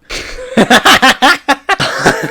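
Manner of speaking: shrieking burst of laughter; loud high laugh. Real, not acted. Streamer cracking up at the game, laughing loudly.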